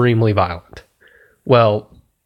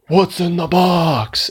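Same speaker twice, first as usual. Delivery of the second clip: movie quote homage; 7 semitones higher, slower than usual. Retold or imitated. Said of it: "and he's like".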